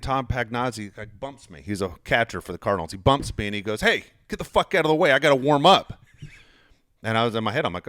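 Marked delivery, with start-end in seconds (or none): 3.8-6.0 higher, louder, brusque retold demand; irritated order. Catcher telling him to clear the way, irritated. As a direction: act out a rude order to move.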